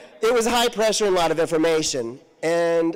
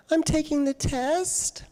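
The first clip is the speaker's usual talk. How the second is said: innocent student; 12 semitones higher than usual. Retold or imitated. Innocent reply to teacher.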